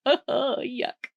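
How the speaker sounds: ordinary delivery, nothing marked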